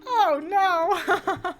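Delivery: laughing through hiccups. Real, not acted. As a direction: giggle after a hiccup. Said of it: "I've got the hiccups".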